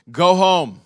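stern, high, loud dismissal; Pastor Claude. Retold or imitated. Pastor sends the persistent church member away, loud command. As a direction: blurt it out as a loud order. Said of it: "a little bit of Pastor Claude there".

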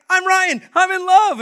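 very high, loud lovestruck outburst; giddy high-pitched shout of happiness; super excited. Retold or imitated. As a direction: blurt it out, bursting with joy. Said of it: "He's just super excited".

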